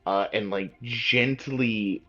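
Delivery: usual speaking voice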